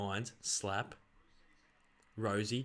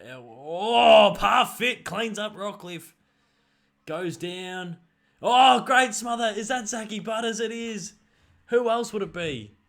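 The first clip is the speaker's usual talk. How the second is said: much higher and louder, slowed call; excited commentator shout; 8 semitones higher, 12 dB louder, slower than usual. Pretend. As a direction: roar the smother like a TV caller. Mock commentary of big smother.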